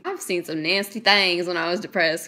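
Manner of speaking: ordinary delivery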